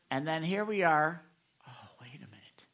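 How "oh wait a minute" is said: hushed whisper of sudden realisation. Acted. Pastor catching himself skipping devotions, in a whisper. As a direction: breathe it out like catching yourself.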